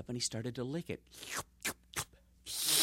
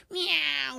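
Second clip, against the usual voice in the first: high cat meow; 12 semitones higher, 6 dB louder than usual. Acted. Cat luring mouse.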